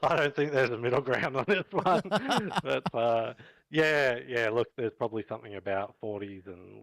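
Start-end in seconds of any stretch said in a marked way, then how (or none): 2.1-2.6 quick burst of high laughter; a sudden bright chuckle; short laugh. Real, not acted. Laughs at skateboard joke.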